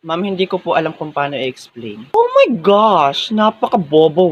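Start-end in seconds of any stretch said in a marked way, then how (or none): 2.1-4.3 slow, drawn-out mild scorn; mocking disbelief. Classmate reacting to a failed answer, mocking disbelief. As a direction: stretch the words out mockingly.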